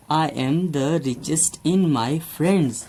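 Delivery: very slow, word by word example sentence; slow dictation. Shown as a demonstration. Teacher dictating an example to students, very slow. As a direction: spell it out slowly for the class.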